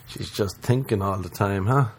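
usual speaking voice

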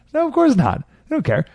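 high, incredulous scoff; scornful dismissal. Real, not acted. Scornful answer to own rhetorical question.

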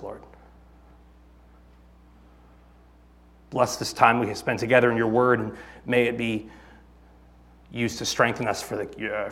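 soft, low closing prayer to the Lord. Real, not acted. Pastor praying over the congregation before the sermon, soft and low. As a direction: pray it quietly and humbly, head bowed.

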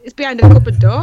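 ordinary delivery

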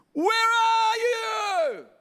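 long, drawn-out, high shouted call; desperate shout. Shown as a demonstration. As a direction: slowly bellow Where are you? as if desperate. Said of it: "he doesn't say it the way I said it".

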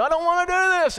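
very high, loud mock protest; hammed-up high-pitched complaint from the old self; old man's whine. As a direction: whine it like a stubborn refusal. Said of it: "that old man's gonna wanna say to you".

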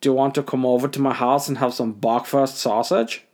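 sexually inappropriate German guy; hammed-up suggestive German come-on; camp German accent. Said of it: "sexually inappropriate German guy".

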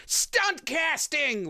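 high, slow nerd whine; outraged fanboy voice. Shown as a demonstration. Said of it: "the side I agreed with spoke in a normal voice".

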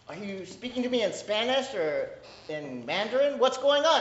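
puzzled, pitch climbing on what's going on; confused question. Retold or imitated. Listener who can't understand someone asks what's going on, baffled. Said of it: "You're like".

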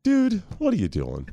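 slow, high-starting bewildered question; baffled protest. Acted. Son asking dad to explain the needling, baffled protest. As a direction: draw it out, puzzled and put upon.

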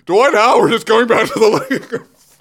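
barely holding it together; laughing news read; reading through laughter. Real, not acted. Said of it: "[name], keep it together".

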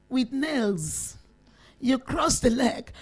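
normal talking voice

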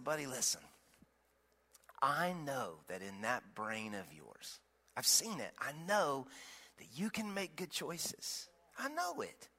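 soft hushed bedtime voice; quiet fatherly murmur to a sleepy boy; whispering to son. Retold or imitated. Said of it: "I'm whispering to him".

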